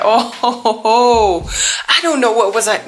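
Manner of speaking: laughing disbelief, pitched high. Real, not acted. Vlogger laughing at her own tattoo decision. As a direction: laugh at your own choice.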